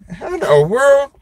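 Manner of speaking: can't believe what he's hearing; incredulous. Real, not acted. Mocking the church's excuse for bites, incredulous. Disbelief at snake handlers' excuse.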